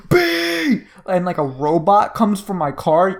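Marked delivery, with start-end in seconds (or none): 0.1-0.8 high, sharp shout of a name; pretend scream for B. Friend pretends to summon his robot guard, shouting high. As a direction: yell the name like calling your robot. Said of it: "I could just scream".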